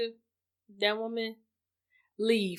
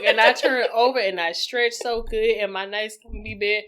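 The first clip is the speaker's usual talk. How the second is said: normal talking voice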